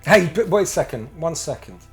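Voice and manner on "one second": normal talking voice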